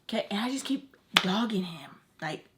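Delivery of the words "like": ordinary delivery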